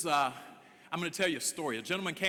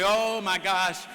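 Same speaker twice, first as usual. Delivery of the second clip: slow, drawn-out, louder mock gasp of despair; mock dismay; 8 dB louder, much slower than usual. Speaker mocking a defeatist morning thought, drawn-out dismay. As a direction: ham up the hand-wringing. Mock self-pity over discrimination.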